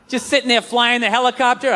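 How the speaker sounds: ordinary delivery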